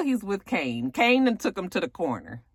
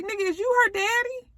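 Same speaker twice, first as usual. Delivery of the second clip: pitched way up in disbelief; incredulous high voice. Retold or imitated. Recapping a show, he voices his own shock in a high incredulous squeal. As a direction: squeal it like you can't believe it.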